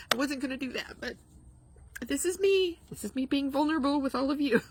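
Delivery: fighting back tears, pitch lifted; getting emotional. Real, not acted. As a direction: voice rising high, close to tears. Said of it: "I'm sorry I'm getting emotional".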